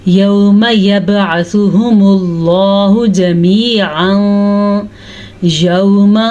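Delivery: slow chanted Quran recitation. Shown as a demonstration. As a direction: chant the verse slowly. Recites verse. (धीमी तिलावत)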